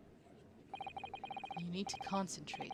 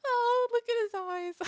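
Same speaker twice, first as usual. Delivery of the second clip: laughing; 9 dB louder than usual. Real, not acted. Laughing at character sprite.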